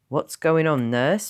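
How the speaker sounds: loud, commanding sister; booming. Acted. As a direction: boom across the ward. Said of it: "boomed a loud noise".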